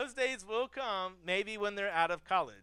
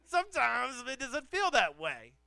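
ordinary talk

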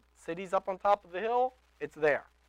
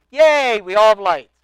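mock cheer; 4 semitones higher, 18 dB louder than usual. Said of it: "He didn't say".

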